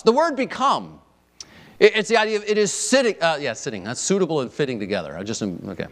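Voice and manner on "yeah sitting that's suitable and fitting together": ordinary talk, nothing marked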